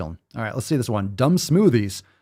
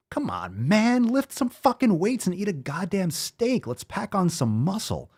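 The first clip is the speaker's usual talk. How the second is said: exasperated urging; 8 semitones higher than usual. Retold or imitated. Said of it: "I'm like".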